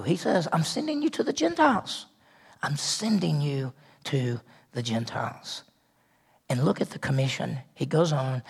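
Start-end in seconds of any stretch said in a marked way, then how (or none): 0.5-2.1 much higher ringing declaration; high proclaiming take; emphatic proclamation. Acted. Repeats commission line twice. 2.6-5.6 lower, much softer, slower repeat; hushed slow restatement; soft echo. Acted. Repeats commission line twice.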